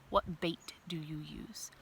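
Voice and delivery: hushed conspiratorial whisper. Real, not acted. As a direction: lean in and whisper. Teacher whispering to the class.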